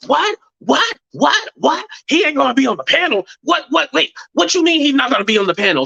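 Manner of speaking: high, rapid-fire incredulous whats; mock outraged disbelief. Retelling how he reacted to the panel news, incredulous. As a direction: imitate him going off, pitch jumping up in disbelief. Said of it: "So he goes off on her".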